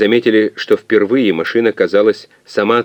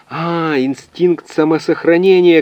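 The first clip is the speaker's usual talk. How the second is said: high, drawn-out cry of realisation; крик догадки; 10 semitones higher, 4 dB louder, slower than usual. A narrator's reading. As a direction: call it out high as the answer dawns. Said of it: "крикнул физик". (выкрикнуть догадку высоким голосом)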